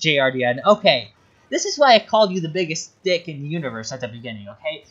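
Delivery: ordinary delivery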